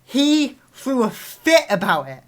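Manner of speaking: usual speaking voice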